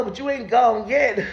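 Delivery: ordinary talk, nothing marked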